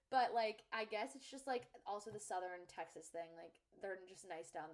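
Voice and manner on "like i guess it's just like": ordinary talk, nothing marked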